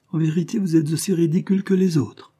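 high-pitched mocking exclamation; exclaiming. A narrator's reading. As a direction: cry it out, pitched well up. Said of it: "s'écria-t-elle". (exclamation aiguë et moqueuse)

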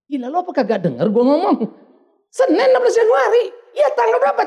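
retold irritation at wife; getting angry. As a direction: snap back, fed up with the question. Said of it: "saya mulai agak marah".

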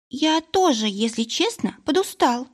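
high squeaky little creature. Acted. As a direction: squeak it out, tired and small. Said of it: "раздалось пищание Тиина".

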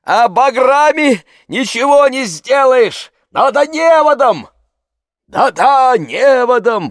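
high, loud, insistent shouts; calling out. Acted. Crowd on shore demands a net, shouting. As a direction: holler advice across the water.

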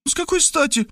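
put-on very high girlish puzzlement; surprised young woman. A narrator's reading. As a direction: question him in high-voiced surprise. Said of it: "удивилась она". (высокий девичий голос, удивлённо)

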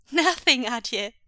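put-on high, loud innocent little boy; feigned innocence. A narrator's reading. Son at top of stairs to father, playing innocent.

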